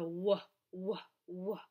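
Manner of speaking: gentle W whoa demo; W sound. Shown as a demonstration. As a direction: stretch the W in whoa, whoa. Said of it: "starts with a".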